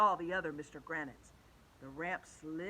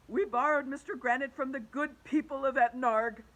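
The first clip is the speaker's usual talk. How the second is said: loud, higher-pitched principal's address; 6 semitones higher, 8 dB louder than usual. A narrator's reading. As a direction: explain firmly as a school principal.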